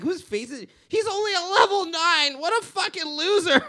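put-on high, loud taunting kid, slowing on the name; imitating a snotty kid laughing at a gamertag; mocking gamer kid. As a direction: jeer like a bratty kid in live chat. Mocking gamer kids quoted.

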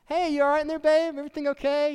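high, light voice of a wife calling through the wall; wife checking in. Retold or imitated. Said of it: "and she's like".

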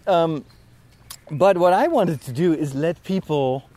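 ordinary talk

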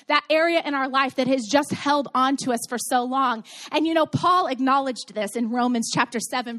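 ordinary delivery

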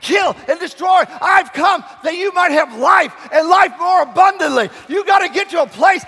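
high, loud shouted preaching; booming motivational speech. Acted. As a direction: preach it at full force. Preaching at full force.

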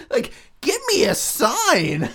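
high, loud, drawn-out punchline; milk the punchline like a hack comic; hammed-up stand-up comic. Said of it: "Transylvania open mic night".

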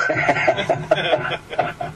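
breathy high laugh; amused real laugh. Real, not acted. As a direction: laugh at the punchline. Laughs at own story.

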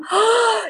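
quick high excited gasp; sharp gasp. Real, not acted. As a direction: suck in air, thrilled by the memory. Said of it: "I have goosebumps thinking about it".

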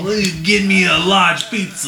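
high exaggerated New York voice; New York accent. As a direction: order like a Brooklyn local. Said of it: "do a New York accent".

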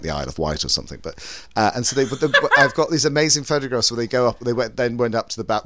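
ordinary talk

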